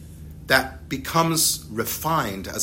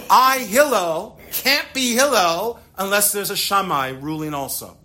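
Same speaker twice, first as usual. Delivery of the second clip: voicing Hillel; 6 semitones higher than usual. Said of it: "Hillel is basically saying".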